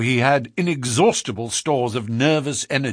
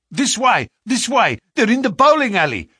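high, loud and rushed; urgent cry; 8 semitones higher, 5 dB louder than usual. A narrator's reading. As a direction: shout them on, hurrying. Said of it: "cried the stranger".

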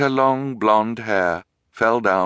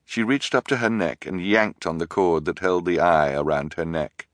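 ordinary delivery

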